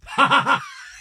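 high, loud mock supervillain cackle; villain cackle. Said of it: "He just starts cackling like he's a villain".

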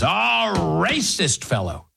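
a sneering, mock-pitying put-on voice, slowed at the end; mocking national media. Mocking the national media's smug verdict on Southerners, sneering. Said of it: "the national media comes in and says".

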